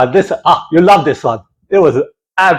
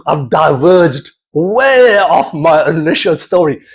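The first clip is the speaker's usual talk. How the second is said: ordinary talk, nothing marked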